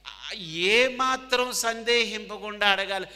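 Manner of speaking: ఏమాత్రం hit high, loud and slow; emphatic preaching. Real, not acted. Preacher telling students ఏమాత్రం without doubt, loud and slow. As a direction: drive home ఏమాత్రం with full force. Preacher stressing key verse.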